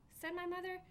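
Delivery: ordinary delivery, nothing marked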